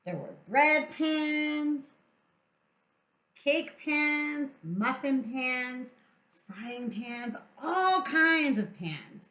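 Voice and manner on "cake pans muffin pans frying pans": ordinary delivery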